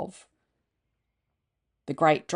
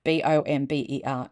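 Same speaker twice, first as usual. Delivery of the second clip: plain reading voice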